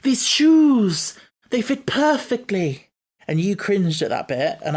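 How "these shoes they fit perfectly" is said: high, loud, drawn-out mock exclamation; imitating the Doctor. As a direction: imitate overjoyed amazement, stretching the last word. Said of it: "and then he goes".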